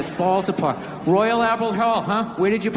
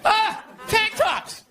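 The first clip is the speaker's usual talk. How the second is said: much higher and slow, dawning awareness; high slow realization; 13 semitones higher, much slower than usual. Retold or imitated. Comic seeing the tank tops, high slow mock realization. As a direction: stretch it out high as it clicks. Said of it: "It's like".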